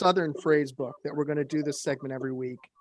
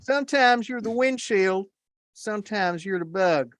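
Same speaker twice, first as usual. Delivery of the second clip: put-on Southern accent; 3 semitones higher than usual. Podcast host reads a country saying to co-hosts, hammed-up Southern accent. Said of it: "in the best damn Southern accent I can".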